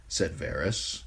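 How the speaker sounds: ordinary delivery, nothing marked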